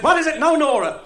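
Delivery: loud, high exasperated priest. Acted. Priest interrupted before the bishop, exasperated and loud. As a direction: throw up your hands at her.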